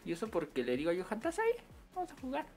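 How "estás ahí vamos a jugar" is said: pitched way up, asking sweetly; high sing-song invite to play; high calling invite. Retold or imitated. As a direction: call out to a friend in a much higher voice. Quoting himself inviting Johan.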